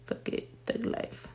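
whispered, under her breath; whispered thug life joke; whispered aside. Real, not acted. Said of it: "make this flower fucking huge".

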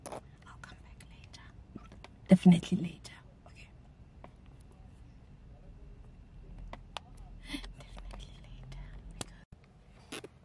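whispered retreat; quiet embarrassed whisper. Real, not acted. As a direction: whisper that you'll come back later. Giving up filming with hiccups.

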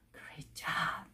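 soft breathy whisper of praise. Real, not acted. Teacher whispering praise to student after drill. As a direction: whisper it like a gentle pat on the back.

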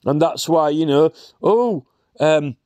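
ordinary talk

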